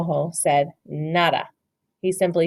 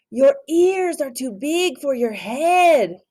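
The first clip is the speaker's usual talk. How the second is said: slow, higher character voice; teasing; 7 semitones higher, 3 dB louder, slower than usual. Acted. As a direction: tease him about his ears. Reader repeats line plainly after.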